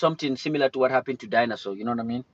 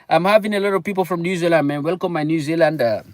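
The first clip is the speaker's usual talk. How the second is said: excited; 6 semitones higher, 6 dB louder than usual. Real, not acted. Excited greeting of viewers.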